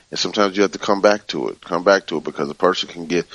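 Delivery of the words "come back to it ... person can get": usual speaking voice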